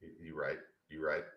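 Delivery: very soft teasing "You right"; hushed teasing. Real, not acted. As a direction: murmur it with a grin.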